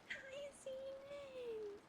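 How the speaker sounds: high, slow, stretched-out hi; drawn-out high sing-song hello. Real, not acted. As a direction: croon the greeting way up high.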